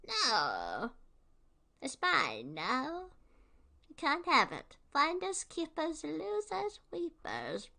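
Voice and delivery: high, sing-song gloating cartoon villain; taunting villain. Villain taunting the kid over the backpack, sing-song gloat. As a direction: taunt like a playground bully.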